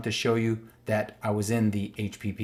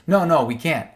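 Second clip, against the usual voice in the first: mock sheepish officials; 4 semitones higher, 6 dB louder than usual. Mock dialogue, officials conceding.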